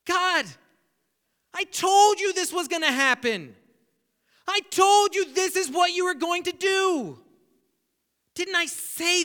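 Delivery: I-told-you-so to God, frustrated; exasperated Jonah. Retold or imitated. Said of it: "He's exasperated".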